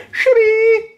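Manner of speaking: silly catchphrase. Acted. Jeffy's phone catchphrase.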